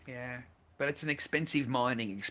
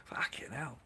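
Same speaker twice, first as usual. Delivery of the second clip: a whispered aside, breath only; hushed curse under the breath; 7 dB quieter than usual. Real, not acted. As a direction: drop to a bare whisper. Muttered aside.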